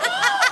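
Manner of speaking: sudden high yelp of fright; mock scream at traffic; panicked scream. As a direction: shriek like a near miss. Mimicking a driver's panic.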